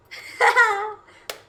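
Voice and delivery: loud burst of real laughter; sudden high laugh; embarrassed laugh. Real, not acted. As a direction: laugh it off, caught out. Laughs at her own mistake.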